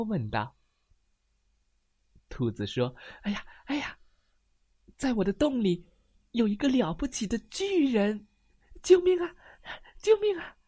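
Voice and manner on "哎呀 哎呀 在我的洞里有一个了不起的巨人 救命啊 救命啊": high, panicky rabbit voice rising to loud cries; a terrified little rabbit blurting it out; squeaky scared bunny; frightened rabbit. Acted.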